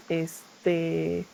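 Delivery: ordinary talk, nothing marked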